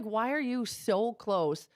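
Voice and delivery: slow, spaced-out retold question; slow, incredulous quoting of oneself; retold exasperation. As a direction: drag out each word in mock disbelief. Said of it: "I was just like".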